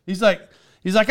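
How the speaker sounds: normal talking voice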